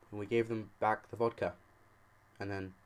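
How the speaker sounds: ordinary delivery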